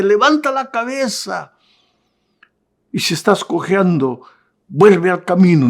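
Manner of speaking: rally them with rising force; rousing exhortation. Acted. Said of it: "lo que Pablo te dice hoy".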